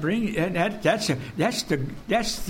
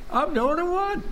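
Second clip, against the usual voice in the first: much higher, whiny mock lament; mock self-pity; 10 semitones higher than usual. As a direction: play a mock whine. Mocking lonely self-pity.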